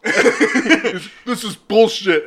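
high, loud, slow protest; outraged at the call; angry player. Retold or imitated. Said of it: "Madison Bumgarner is gonna be like".

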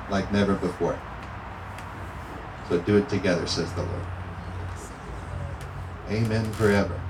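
soft, intimate prayer voice; quiet prophetic prayer. Acted. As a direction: pray it softly over them.

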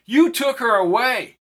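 high, loud, anguished cry; anguished scream. A narrator's reading. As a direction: scream the accusation at Ricardo.

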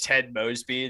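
ordinary delivery

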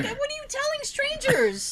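very high-pitched incredulous question; mock indignation. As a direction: pitch it way up in outraged disbelief. Retold indignation at sister.

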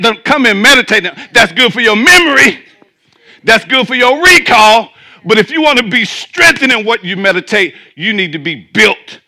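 loud, high-pitched preaching at full force; predigen mit voller Wucht. Real, not acted.

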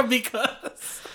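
put-on valley girl.

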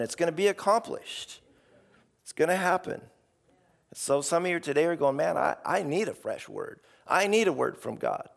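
usual speaking voice

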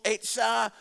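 ordinary talk

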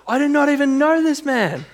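high, forceful insistence, pushed up hard; emphatic denial. Retold or imitated. Peter cursing, denying Jesus before bystanders, emphatic. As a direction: swear you never met him. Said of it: "he's emphatically, he's calling down a curse".

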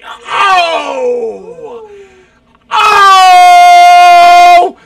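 long, high, very loud wail; loud holler. Real, not acted. Host hollers mid lightning round, a long loud scream. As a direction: let out a huge sustained "Oh!".

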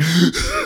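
high, hiccuping mock sobs; imitated sobbing gasps. Child too angry to tell mother, mock sobbing gasps. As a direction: gasp as if crying too hard to speak. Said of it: "screaming and hollering and crying".

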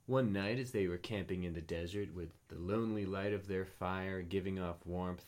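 ordinary delivery, nothing marked

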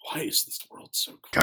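breathy whispered lament, hammed-up; mock despair. Finding a vegetarian menu, whispered mock-tragic lament. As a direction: sigh it out like a tragic hero. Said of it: "And I'm like".

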